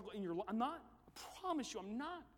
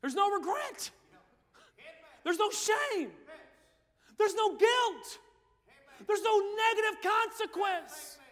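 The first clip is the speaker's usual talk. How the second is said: higher, louder shouted declarations; preaching at full force; 12 semitones higher, 16 dB louder, slower than usual. Acted. Preacher shouting assurance to the youth crowd. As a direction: ring out each no.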